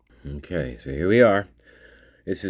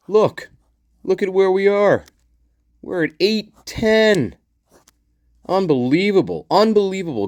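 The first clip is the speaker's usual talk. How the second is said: excited; 8 semitones higher than usual. Real, not acted. Excited about channel's subscriber number.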